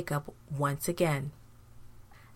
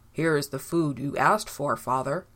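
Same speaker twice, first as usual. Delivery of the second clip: lower-voiced son presenting food; announcing son; 3 semitones lower than usual. A narrator's reading. Son bringing his father food, announcing it. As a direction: announce it in a slightly deeper voice. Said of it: "announced Esau".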